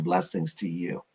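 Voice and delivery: ordinary delivery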